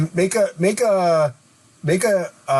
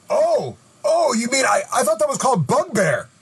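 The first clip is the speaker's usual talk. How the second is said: higher, surprised hobgoblin voice; a creature catching on, pitched up; hobgoblin realizing; 7 semitones higher than usual. Acted. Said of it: "He says".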